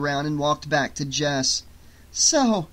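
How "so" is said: higher, bright and lifted; a sudden cheerful opener after a close call; brightly. A narrator's reading. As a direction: put on a cheery front. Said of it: "she said brightly".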